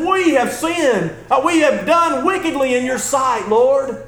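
Daniel confessing before God; earnest confession. Acted. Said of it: "He says".

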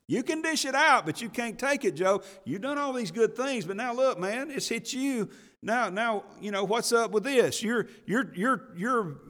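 high-pitched, pressing mock scolding; harsh rebuke. Preacher voicing Eliphaz scolding suffering Job, harsh and high. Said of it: "he's being harsh with Job".

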